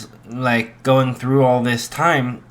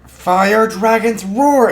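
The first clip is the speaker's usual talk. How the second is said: higher, louder, slow battle cry; dragon roar; 9 semitones higher, 6 dB louder, slower than usual. Retold or imitated. Igneel blasting fire at his son, loud slow roar. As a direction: bellow the attack at the boy. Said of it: "as Igneel goes".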